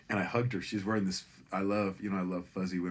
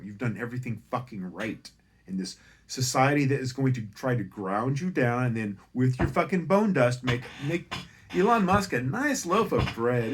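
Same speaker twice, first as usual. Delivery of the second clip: loud, higher impassioned tirade; passionate rant; 5 semitones higher, 10 dB louder than usual. Retold or imitated. Comic ranting to Amazon driver, loud impassioned tirade. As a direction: rail against the system.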